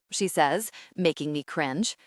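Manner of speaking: ordinary delivery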